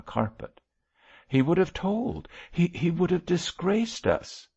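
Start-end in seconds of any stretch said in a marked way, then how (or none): 1.3-4.5 high-pitched, anxious protest; distressed protest. A narrator's reading. Bishop to wife about threatened disgrace, distressed. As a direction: plead the danger in a raised voice.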